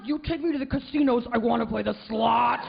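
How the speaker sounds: imitating a crabby old lady; grumpy old-woman demand; angry old woman. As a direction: put on a cantankerous old gambler's voice. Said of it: "this angry old woman".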